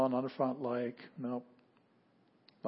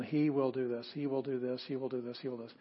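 he stressed each time, fading softer; 3 dB quieter than usual. Shown as a demonstration. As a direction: hammer he, letting it fade. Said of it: "we see the emphasis on".

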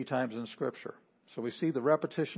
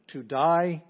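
"To die" pitched up and louder; emphatic; 4 semitones higher, 7 dB louder than usual. Retold or imitated. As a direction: punch "die" high and strong. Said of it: "But it's emphatic".

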